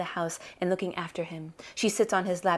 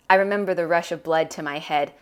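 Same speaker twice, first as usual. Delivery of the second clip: usual speaking voice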